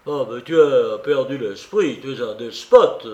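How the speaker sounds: loud outraged cry; vociferating. A narrator's reading. Razoumikhine yelling at Raskolnikov, outraged. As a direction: shout him down, outraged. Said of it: "vociféra".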